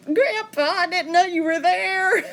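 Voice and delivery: high, loud, squealing with surprise and embarrassment; mock-shocked high cry to grandpa; startled exclamation. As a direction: yelp it out like you just got caught. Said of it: "I thought".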